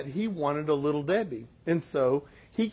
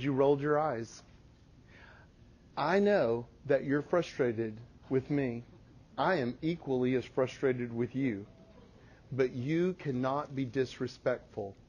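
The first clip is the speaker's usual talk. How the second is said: softer, low, calm scolding; soft-spoken stern mom; quiet firm correction; 4 semitones lower, 7 dB quieter than usual. Retold or imitated. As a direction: keep it hushed and steady.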